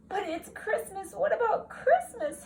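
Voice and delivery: little brother calling after his sister, high; whining little brother. Acted. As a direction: protest like a disappointed little boy. Brother's complaint.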